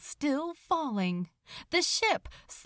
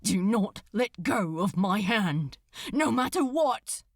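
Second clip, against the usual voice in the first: louder, sharp and clipped; jagged command; 4 dB louder than usual. Acted. Crowley ordering Aziraphale to hold his hand, fierce. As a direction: bite out each word like ice. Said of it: "words as jagged and distinct as shards".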